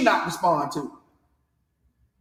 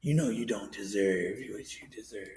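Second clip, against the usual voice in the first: much lower, much softer seductive hush; late night DJ voice; 11 semitones lower, 16 dB quieter than usual. Retold or imitated. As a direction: murmur it like a radio seducer. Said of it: "sit there and whisper".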